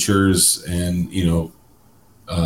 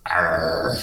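growled pirate arr; a pirate's arr; 3 dB quieter than usual. Acted. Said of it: "No, I sound like a pirate".

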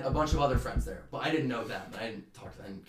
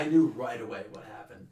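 usual speaking voice

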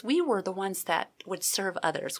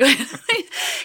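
laugh; 7 semitones higher, 10 dB louder than usual. Real, not acted. Laughs at own story.

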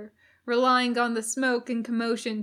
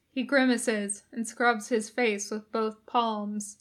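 ordinary delivery

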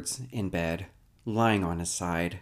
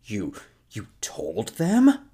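louder and slow, stumbling on the first word; stunned outburst; 7 dB louder, slower than usual. Retold or imitated. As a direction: blurt it out, hurt and shocked. Edwards learns Grace wrote them.

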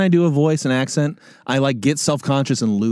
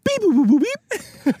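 much higher chirpy mock droid beeps; imitating robot beeps; 14 semitones higher, slower than usual. Retelling a classmate's bad R2-D2 impression, high robot beeps. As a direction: beep like a toy robot. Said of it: "he led with".

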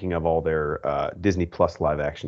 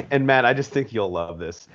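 ordinary talk

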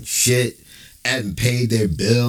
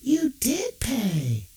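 call-center rep faking sympathy; phone rep Mindy; 7 semitones higher, 4 dB quieter than usual. Acted. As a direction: gush over the caller with fake pity. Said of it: "she comes in".

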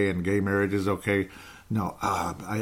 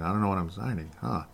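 imitating a mumbled, softer aside to himself; mock Biden mumble; 7 dB quieter than usual. Commentator mocking Biden signing papers, soft mock mumble. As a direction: mutter it like a confused old man at his desk. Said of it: "You can hear him mumbling that".

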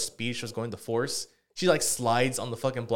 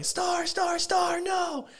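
high-pitched imitated yell after a runaway dog; yelling the name, far higher than usual; retold yell; much slower than usual. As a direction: call the dog back in a high, strained yell. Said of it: "I'm yelling".

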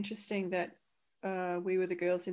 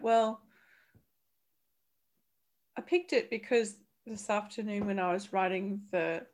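ordinary talk, nothing marked

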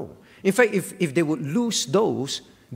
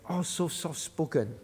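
mock soft-spoken; 6 dB quieter than usual.

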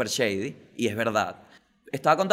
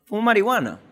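más alto y agudo, declarándolo; suéltalo fuerte y sin miedo; confesión en voz alta; 7 semitones higher, 4 dB louder than usual. Shown as a demonstration. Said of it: "¿verdad?". (confesión fuerte, tono más alto)

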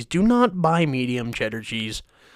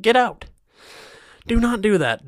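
higher, louder fed-up snap; annoyed outburst; 9 semitones higher, 6 dB louder than usual. Real, not acted. Ranting at the mac and cheese maker, fed up. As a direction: blow up at the brand.

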